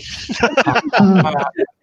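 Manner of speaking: a burst of high rising laughter. Real, not acted. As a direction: laugh it off, amused at himself. Laughing at his own crash story.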